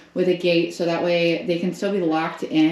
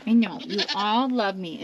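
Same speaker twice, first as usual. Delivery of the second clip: ordinary talk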